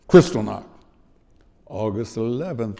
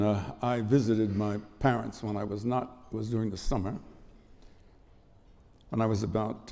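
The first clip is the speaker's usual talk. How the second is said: normal talking voice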